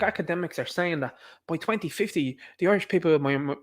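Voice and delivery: usual speaking voice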